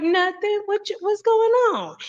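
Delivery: put-on high, sweet elderly auntie greeting; a fond imitation of a hundred-and-five-year-old aunt, high. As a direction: lift the voice up into a frail old auntie.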